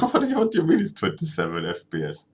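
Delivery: laughing, incredulous question; mock disbelief. Real, not acted. Streamer reacts to chat's frame rate claim with laughing disbelief. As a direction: pretend to be shocked at the number.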